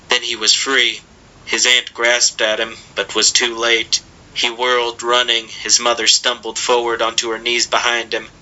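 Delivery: ordinary delivery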